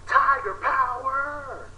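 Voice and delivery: high, slow, drawn-out cry; catchphrase. Acted. Reviewer's sign-off catchphrase, sung out high. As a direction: stretch it out like a battle cry.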